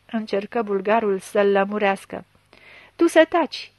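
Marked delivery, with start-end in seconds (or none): 3.0-3.7 louder, higher order to shut up; sharp rebuke. A narrator's reading. Chief silences the suspect's meddling friend, sharp rebuke. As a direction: cut the friend off.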